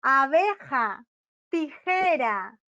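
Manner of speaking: Abeja and tijera slowly split into syllables; abeja, tijera, separadas en sílabas. Shown as a demonstration. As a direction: stretch Abeja and tijera beat by beat. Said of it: "Son palabras que tienen tres sílabas".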